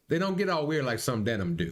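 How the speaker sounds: lightly voiced masked character; through a balaclava. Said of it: "Sorry, that was Balaclava guy".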